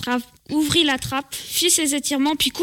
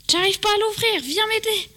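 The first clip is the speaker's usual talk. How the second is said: appel à l'aide; 7 semitones higher than usual. Acted. Boy cannot open trapdoor.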